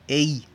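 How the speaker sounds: "E I" misread as one glide "ey"; lectura incorrecta. Shown as a demonstration. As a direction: run "E I" together as "ei", e into i. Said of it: "no se lee". ("E I" leído como diptongo "éi")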